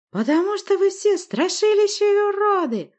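very high, loud crazed witch; screeching derision. A narrator's reading. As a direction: shriek the insult at them. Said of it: "расхохоталась отвратительно визгливым хохотом".

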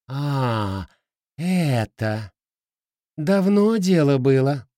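lightly voiced swamp creature. Swamp king answers the princess.

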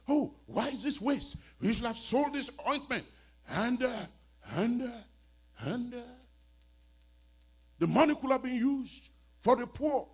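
high, loud grumbling Judas; a hammed-up whiny protest; mocking whiner. Preacher mimics Judas complaining.

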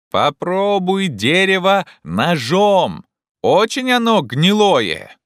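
very high, slow, loud call; shouting up. Acted. Legrand has Jupiter test the wood, shouting up. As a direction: make the words carry upward.

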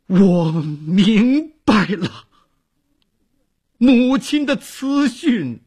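choked up, breaking the words apart; deeply moved. A narrator's reading. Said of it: "他动情地说".

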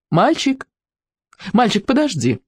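high calling out down the street; shouting after a boy. A narrator's reading. Shop woman calling a boy on the street, shouting. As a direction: call after him across the street. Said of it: "крикнула на всю улицу".

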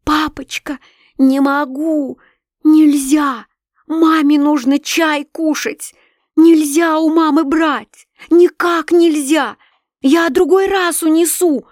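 put-on high, loud, slow begging child; a daughter pleading with her father; desperate pleading. A narrator's reading. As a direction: beg on your knees, drawing out each word. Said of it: "бросаясь на колени и умоляя его".

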